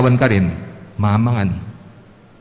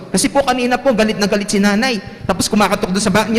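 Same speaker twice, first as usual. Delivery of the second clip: put-on much higher, fast little boy; kid explaining; 8 semitones higher, faster than usual. Reynold explaining to the teacher, high and fast. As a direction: rattle it off like an eager kid.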